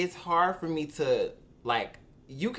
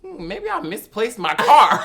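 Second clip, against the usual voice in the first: mock clueless; 4 semitones higher, 7 dB louder than usual. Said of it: "my ass still walk".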